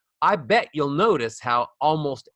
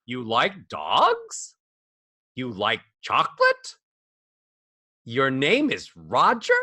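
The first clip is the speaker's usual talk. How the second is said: each line slow, ending on a rise; rising question tune; slower than usual. Shown as a demonstration. Voice coach showing a question rising at the end. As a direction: lift the end of every question. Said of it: "allowed to go up when you have a question mark".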